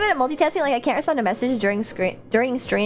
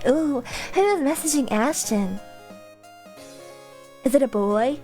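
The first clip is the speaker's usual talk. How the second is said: mock mysterious; 3 dB quieter than usual. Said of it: "throw in a little mystery".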